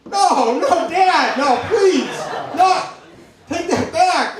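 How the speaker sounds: high-pitched mortified begging; desperate pleading. Retold or imitated. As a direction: plead with Dad to stop embarrassing you. Embarrassed son begging dad to stop.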